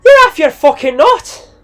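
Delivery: furious, high, loud Irish outburst; Irish yell. Acted. Irish organiser yelling at Ronnie over the price, furious. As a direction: yell the insult in an Irish accent. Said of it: "yelled the Irish organiser".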